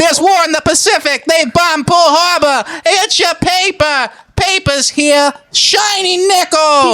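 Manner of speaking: put-on high, slow old-time newsboy shouting; 1945 newsboy. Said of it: "Nineteen forty-five American selling newspapers".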